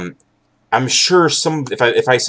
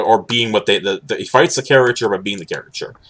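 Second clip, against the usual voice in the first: ordinary talk, nothing marked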